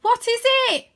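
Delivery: high, loud anxious call; lightly voiced boy calling up; hopeful call. A narrator's reading. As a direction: shout up the question. Arthur calls up hopefully.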